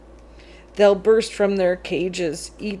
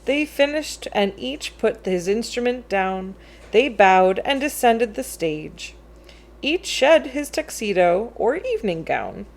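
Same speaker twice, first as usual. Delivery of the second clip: plain reading voice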